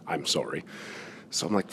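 ordinary talk, nothing marked

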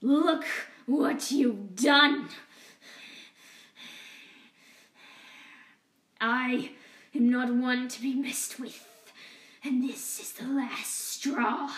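loud, slow villain threat; menacing rage. Acted. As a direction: lean in and threaten. Rat King's angry threat.

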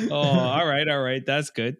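ordinary delivery

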